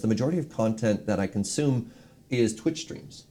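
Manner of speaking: normal talking voice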